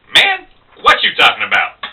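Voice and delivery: incredulous barroom comeback; local guy. Acted. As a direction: cut through the stranger's fancy talk. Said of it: "the local guy".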